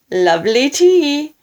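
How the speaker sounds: high, loud, gleeful relish; grinning. A narrator's reading. Man tempting grandma with tea, loud gleeful grin. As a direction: sing its praises with a huge grin. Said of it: "grinning hugely".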